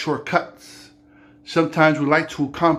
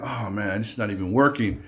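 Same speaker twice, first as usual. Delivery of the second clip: a touch of letdown, lower and softer; disappointed sigh; 5 semitones lower, 5 dB quieter than usual. Acted. Naaman checks his arms, mildly disheartened. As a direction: Naaman mildly let down, dropping low.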